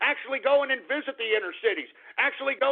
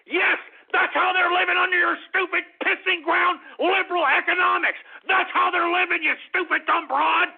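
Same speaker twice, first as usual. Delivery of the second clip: loud, high-pitched angry tirade; furious rant; 8 semitones higher, 4 dB louder than usual. Real, not acted. Host raging at Hillary Clinton over poverty, furious shouting. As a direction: yell it at her, fully unloaded.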